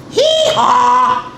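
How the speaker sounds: loud, high hee-haw; big drawn-out donkey call; donkey bray. A narrator's reading. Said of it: "Like Wonky Donkey".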